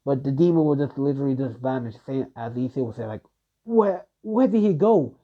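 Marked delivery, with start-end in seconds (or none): 3.6-5.2 much higher, slow panicked question; startled search. Acted. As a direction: cry out, searching in alarm. Issei loses sight of the demon.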